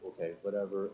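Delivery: soft, low, defeated; quiet hurt shrug; dejected mutter. Retold or imitated. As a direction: mumble it like a kid giving up. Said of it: "I'm just like".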